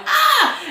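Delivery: short, high yelped "ah"; re-enacted scream. Reader recalling the scene that shocked her, re-enacted scream. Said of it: "I get to this scene and I screamed".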